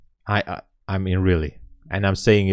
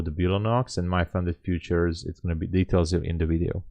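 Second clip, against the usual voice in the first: ordinary talk, nothing marked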